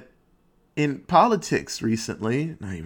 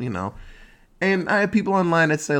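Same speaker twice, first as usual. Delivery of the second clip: usual speaking voice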